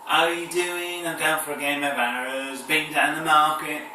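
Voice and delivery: high, put-on Cockney patter; Cockney accent. Shown as a demonstration. Showing viewers the south's accents, hammed-up Cockney. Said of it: "the Cockney accent".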